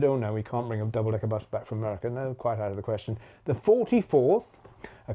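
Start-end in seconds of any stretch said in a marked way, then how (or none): 0.0-3.2 rattled off fast, mildly dismissive; fast offhand no from the freight firms; brisk dismissive refusal. Retold or imitated. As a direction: brush it off quickly as out of the question. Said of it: "all said".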